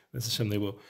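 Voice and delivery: ordinary talk, nothing marked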